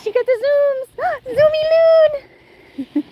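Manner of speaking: very high delighted squeal; excited pet talk. Real, not acted. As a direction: gasp and squeal at the dog. Gushing at dog.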